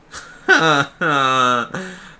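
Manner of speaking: loud, drawn-out laugh; real burst of laughter; amused laugh. Real, not acted. Player amused by the Minotaur joke, loud laugh.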